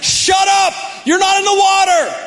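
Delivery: high, loud, exasperated shout back; mock angry retort. Preacher imagining himself yelling at the stranger, mock angry shout. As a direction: snap back at the know-it-all on shore. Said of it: "I instantly would have been like".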